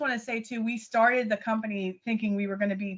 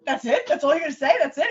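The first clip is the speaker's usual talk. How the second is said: disbelief; 5 semitones higher, 5 dB louder than usual. Retold or imitated. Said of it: "I'm like".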